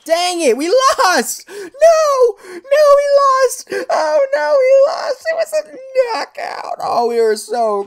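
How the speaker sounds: very high, loud, crying wail; wailing over defeat. Real, not acted. Player whose team just lost, wailing high shout. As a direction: howl the loss like a sobbing shout.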